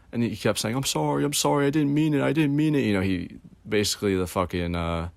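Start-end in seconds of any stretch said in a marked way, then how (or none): 0.8-2.8 higher, pleading sorry, lightly voiced; retelling the crying worker's apology, high and pleading; mock pleading apology. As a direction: imitate a tearful guy begging forgiveness.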